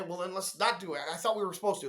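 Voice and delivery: ordinary talk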